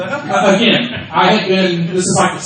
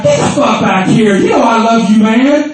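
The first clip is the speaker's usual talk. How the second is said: loud, higher-pitched retold call; 6 semitones higher, 5 dB louder than usual. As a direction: holler it like you're calling someone back. Calling through the curtain, retold.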